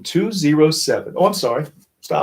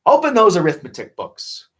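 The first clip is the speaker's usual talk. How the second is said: stern teacher order; 6 semitones higher, 4 dB louder than usual. Acted. Miss Swamp gives orders.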